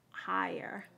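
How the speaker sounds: breathy whisper of one word; whispered aside. Real, not acted. Aside while applying makeup.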